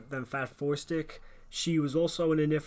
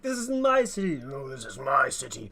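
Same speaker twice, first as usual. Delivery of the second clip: raised-voice hammed-up argument; mock shouting match; 6 dB louder than usual. Rivals shouting at each other behind a table, mock outrage. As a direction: argue back and forth in mock outrage. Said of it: "them just shouting at, uh, each other".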